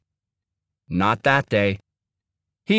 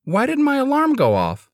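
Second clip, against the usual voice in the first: surprised. Acted. Sleepy boy wakes late.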